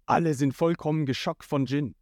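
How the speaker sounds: ordinary delivery, nothing marked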